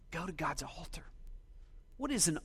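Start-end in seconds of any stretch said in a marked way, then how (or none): 0.1-1.0 hushed whispered repeat of the line; soft intimate whisper, almost no voice. Shown as a demonstration. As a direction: breathe it as a whispered echo.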